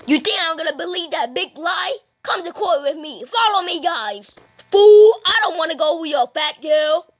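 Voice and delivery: ordinary talk, nothing marked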